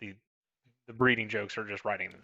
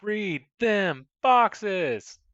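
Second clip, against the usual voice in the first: slow, drawn-out yell, higher and louder; 11 semitones higher, 9 dB louder, much slower than usual. Shown as a demonstration. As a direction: bellow it out slowly. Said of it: "Just yell it really loud".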